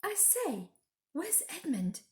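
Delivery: high, sudden alarmed question; a girl suddenly noticing her brother is gone. A narrator's reading. Lucy asking the others, suddenly alarmed. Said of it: "Lucy suddenly said".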